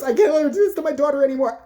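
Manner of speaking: high, loud mock outburst; pissed-off mom. Angry mom protesting the coach's treatment, loud outburst. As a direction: blurt it out as a fed-up mother. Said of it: "one of the moms gets pissed off".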